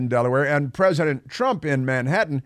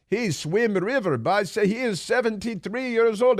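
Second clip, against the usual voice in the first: higher-pitched broken-English mock Chinese accent; jokey mock Chinese accent with dropped grammar; 8 semitones higher than usual. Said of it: "I'm doing, uh, not a Chinese accent".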